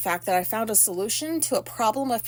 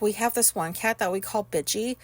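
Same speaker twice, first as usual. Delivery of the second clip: ordinary delivery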